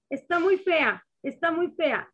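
put-on blurting out her shock at the tiny baby; shocked first reaction. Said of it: "mi primera reacción fue".

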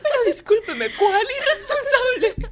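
high, loud, laughing indignation, slowed on the end; playful imitation of an outraged mom; mock indignant mother. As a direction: ham up the offended mother while laughing. Mocks mother defending daughter.

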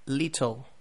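"little" the British way, crisp middle T, falling; British demo of "little" with a closing fall; British accent. Shown as a demonstration. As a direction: model British "little" with a clearly released T. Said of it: "it sounds just like a T".

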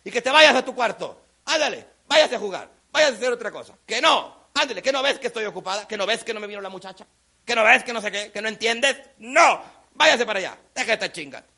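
put-on loud, brusque mom shooing her kid away; scolding mother. Busy mom sends her kid off to play, exasperated scolding. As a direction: rattle off an exasperated telling-off. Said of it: "voltea y te dice".

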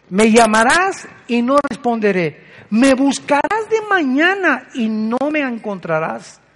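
declamado fuerte, la segunda frase más lenta; proclámalo como la voz de Dios desde el púlpito; proclamación fuerte. Acted. Predicador proclama la cita de Proverbios. (proclamación fuerte y lenta)

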